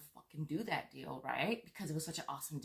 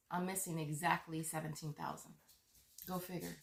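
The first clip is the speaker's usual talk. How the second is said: usual speaking voice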